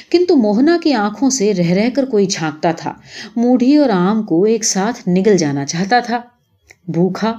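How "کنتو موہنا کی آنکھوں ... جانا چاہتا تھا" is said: ordinary delivery, nothing marked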